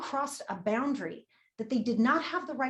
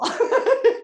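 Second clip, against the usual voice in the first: bright burst of laughter; 13 semitones higher, 12 dB louder than usual. Real, not acted. Laughs at own joke.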